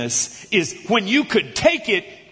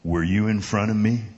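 big guy confronting; 8 semitones lower than usual. Retold or imitated. Big guy to the kid who cut in line, low confrontation. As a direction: confront him in a deep, measured voice. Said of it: "he says".

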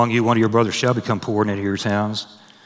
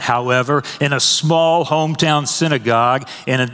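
higher and louder, driving the sermon upward; full-force preaching; 7 semitones higher, 3 dB louder than usual. Acted. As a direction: preach it out, climbing with fervour.

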